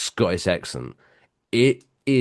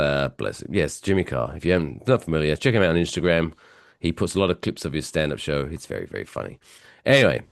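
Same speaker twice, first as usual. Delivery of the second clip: ordinary talk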